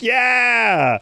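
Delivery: long, high drawn-out cheer; stretched high hurrah; imitated crowd cheer. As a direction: cheer like a whole arena. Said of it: "they're like".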